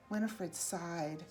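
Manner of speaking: ordinary delivery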